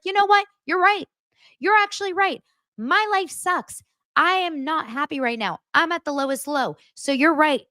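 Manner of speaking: high, exaggerated voice of resigned agreement; imitating Dorit. Dorit conceding to Sutton that her life sucks, high mock voice.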